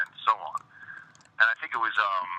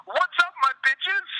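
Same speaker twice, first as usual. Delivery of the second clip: much higher, slow, put-on squeal; a goofy sing-song to break the silence; squeaky greeting; 3 dB louder, slower than usual. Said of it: "Like that".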